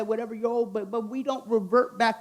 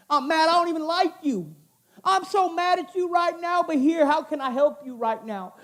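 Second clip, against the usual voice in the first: mock mad; 6 semitones higher, 3 dB louder than usual. Acted anger then giving.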